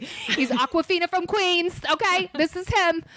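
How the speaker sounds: camp it up like a Queens talk show host; laughing Queens accent, hammed up. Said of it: "Coffee Talk".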